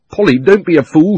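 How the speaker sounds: put-on boy shouting in alarm. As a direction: yell a warning at her. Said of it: "he shouted".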